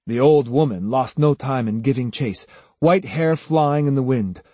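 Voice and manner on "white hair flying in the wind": plain reading voice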